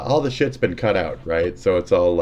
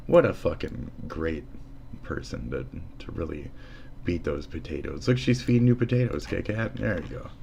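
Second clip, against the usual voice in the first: normal talking voice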